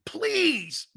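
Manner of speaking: hammed-up high plea; mock begging. Real, not acted. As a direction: beg in a high, stretched-out whine. Begging again.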